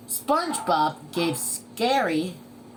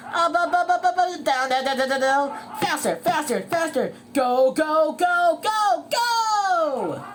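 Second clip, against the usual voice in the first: high, loud rapid-fire commands; urgent coaching; 10 semitones higher, 6 dB louder than usual. Acted. Coach shouting training commands.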